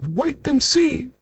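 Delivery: a small voice with a grin; grinning tiny voice. Acted. Tiny Mister Small teases the narrator, high and grinning. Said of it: "grinned Mister Small".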